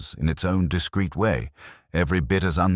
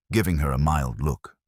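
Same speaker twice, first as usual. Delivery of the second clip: ordinary delivery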